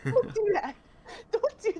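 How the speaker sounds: high-pitched, repeated pleading objection; playful protest. Real, not acted. Friend objecting to being told to ask Isaac, playful protest.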